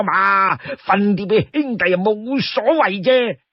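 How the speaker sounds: high-pitched, animated sworn brother; eager, wheedling Li Kui. Acted. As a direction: wheedle your brother with big swooping pitch. Storyteller plays wheedling Li Kui.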